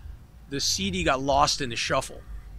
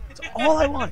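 "That's all I wanted" laughed out high; laughing repeat; 12 semitones higher, 6 dB louder than usual. Real, not acted. Repeating his wish to the hosts, laughing.

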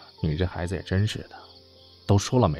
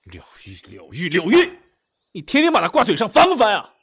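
jealous irritation building; 4 semitones higher, 6 dB louder than usual. Acted. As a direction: start under your breath, explode in exasperation. Jealous outburst at repeated name.

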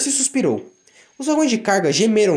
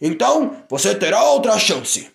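leve voz de chefe, agora tolerante; chefe mais brando; 3 semitones higher than usual. Acted. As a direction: concede a chance com firmeza. Chefe perdoa Daisy. (chefe perdoa Daisy, firme e brando)

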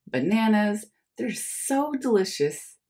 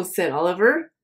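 usual speaking voice

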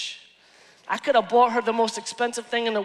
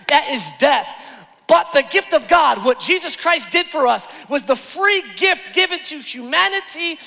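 raised, emphatic gospel proclamation; full-force preaching; 6 semitones higher, 5 dB louder than usual. Real, not acted. Preacher explains the gift of God, impassioned preaching. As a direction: drive every phrase home like a preacher.

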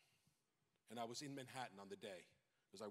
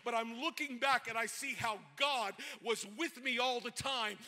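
high, loud, rousing sermon climax; full-force preaching; 8 semitones higher, 9 dB louder than usual. Acted. Pastor rousing the congregation, loud and high. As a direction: preach it with rising fire.

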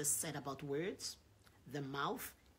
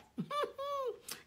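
high delighted laugh. Real, not acted. Preacher laughs at God's line.

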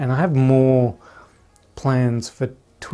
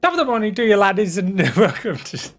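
higher mock Irish intro, breaking into a laugh; Jacksepticeye impression; 7 semitones higher, faster than usual. Vlogger retries Jacksepticeye's Irish intro, laughing. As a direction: try the Irish greeting and crack up. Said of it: "I can't do it".

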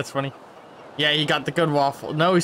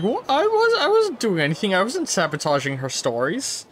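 high-pitched feigned innocence; mock innocence; 7 semitones higher than usual. Player voicing a character caught sabotaging, mock innocence. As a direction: squeak out a put-on denial. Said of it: "standing there like".

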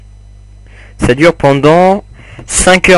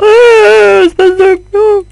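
squeaky, much higher insult with a cry; mock insult; 5 dB louder, slower than usual. Gamer hurling a silly insult at an enemy, squeaky mock cry. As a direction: shriek a silly insult at a monster.

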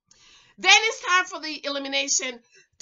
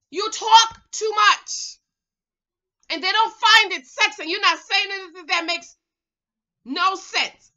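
loud, high telling-off; exasperated scolding; 5 semitones higher, 7 dB louder than usual. Acted. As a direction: scold her emphatically, word by word. Scolds Simone as if present.

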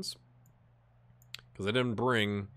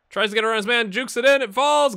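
higher, louder, building to the goal; excited commentary; 11 semitones higher, 9 dB louder than usual. Real, not acted. Gamer calling his team's goal, excited rising call. Live highlight play-by-play.

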